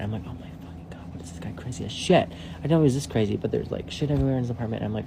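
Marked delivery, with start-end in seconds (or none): 0.3-2.3 secretive whispered aside; horrified whisper. Retold or imitated. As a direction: whisper it so he can't hear. Aside about crazy guy.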